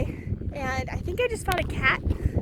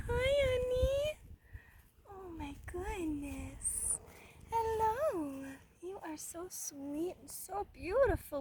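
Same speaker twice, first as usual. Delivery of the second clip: tender cooing; 9 dB quieter than usual. Real, not acted. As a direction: melt over the kitten, almost under your breath. Tender words to the cat.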